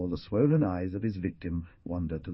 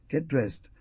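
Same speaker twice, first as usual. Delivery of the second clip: rough order; 6 semitones higher than usual. Acted. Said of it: "he ordered roughly".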